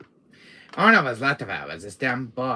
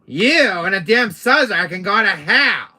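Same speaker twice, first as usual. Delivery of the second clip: loud, raised-pitch cursing; exasperated, hammed-up yell; angry outburst; 6 semitones higher, 12 dB louder than usual. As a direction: lash out at the two of them. Cursing the annoying voice.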